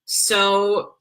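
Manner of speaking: ordinary delivery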